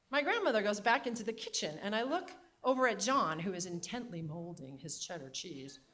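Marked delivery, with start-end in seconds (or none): none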